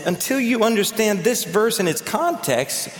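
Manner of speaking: normal talking voice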